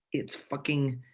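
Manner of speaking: ordinary delivery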